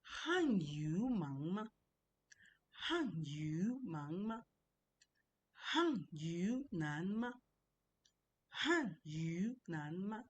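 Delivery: "Han yu nanma" very slow, each syllable's tone drawn out; tone demonstration. Shown as a demonstration. Teacher giving the second sentence, slow tone demonstration. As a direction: model "han yu nanma" slowly, swooping through the tones. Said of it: "Then the second one is".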